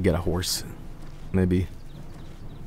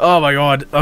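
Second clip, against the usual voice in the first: yawning; 4 semitones higher, 3 dB louder than usual. Real, not acted. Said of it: "I hate yawning on stream".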